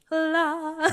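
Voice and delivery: high sung-out sigh of delight; swooning "la". Retold or imitated. Telling of a handsome runner coming closer, swooning. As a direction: go giddy with a high "la".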